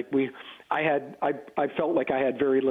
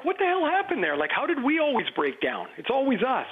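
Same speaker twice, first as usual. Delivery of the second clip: much higher, exasperated question; exasperated outburst; 9 semitones higher than usual. Retold or imitated. A teammate venting about the breakdowns, much higher pitched. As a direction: throw it up in disbelief. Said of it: "you'd be like".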